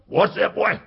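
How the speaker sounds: loud barked question; loud macho father demanding; gruff father. Retold or imitated. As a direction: bark it at the boy. Father barks at son.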